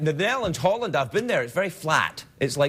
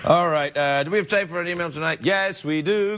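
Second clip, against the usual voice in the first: normal talking voice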